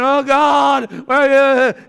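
high, loud griping wail; mock whining. Preacher mimicking men when the bills come, mock whining. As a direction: wail it out in exaggerated despair. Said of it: "whining, griping".

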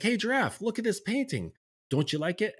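high, eager little bear showing off his painting; piped up like a proud small cub. Retold or imitated. Little bear proudly asks Giraffe about his painting, high and eager. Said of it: "And he's like".

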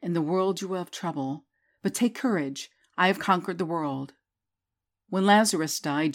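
normal talking voice

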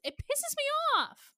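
exasperated. Real, not acted. End of rant.

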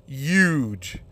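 "huge" as a drawn-out "yooj"; H dropped. Retold or imitated. Questioning whether it is even a word, "huge" without its H. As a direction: say "huge" with no H at all. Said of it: "but without the H".

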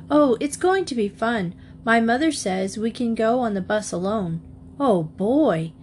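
plain reading voice

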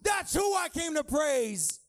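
high, loud, half-sung preaching; preaching at full force. Real, not acted. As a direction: build to a shouted, sung climax.

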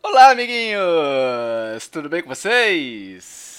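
put-on slow, loud kids' show host greeting; animated. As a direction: stretch it out like a cartoon host. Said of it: "É essa animação".

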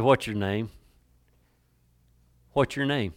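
soft, caring question; hushed, tender inquiry; gentle Jesus. Acted. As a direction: ask it quietly, with compassion. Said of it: "Don't that sound like something Jesus would say?".

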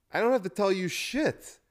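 high, mocking retort from a rude doctor; imitating a doctor who brushes off a sales call. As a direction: toss it off like a smug jerk on speakerphone. Rude doctor retold on phone.

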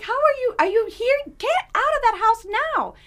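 panicked coworker urging escape; alarmed urgency. Retold or imitated. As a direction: urgently beg her to leave now. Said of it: "She's like".

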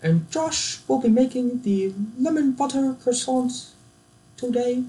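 high-pitched, prim British presenter; badly faked posh British accent, held high; monotone British accent. Baking show host announcing the challenge, monotone British accent. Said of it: "Great British Baking Show hosts, they have no charisma".

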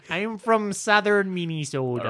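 laughing put-on Minnesota voice; jokey half-Jamaican Minnesota twang; mock Minnesota accent. Host keeps up the bit for cohosts, laughing mock accent. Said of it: "you were a little bit Jamaican".

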